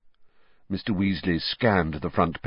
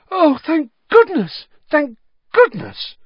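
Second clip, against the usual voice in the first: high, slow, crying out in relief; a frantic mother calling out her relief; relieved cry; 8 dB louder, slower than usual. Acted. Mother sees her family come home, high relieved cry. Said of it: "a cry echoed along the damp lane".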